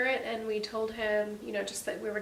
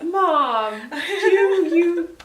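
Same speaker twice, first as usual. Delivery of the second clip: high, loud laughing quote; a son laughing as he admits his mom was right; laughing through tears; 8 semitones higher, 15 dB louder, much slower than usual. Retold or imitated. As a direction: gasp it out half laughing. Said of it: "he was like".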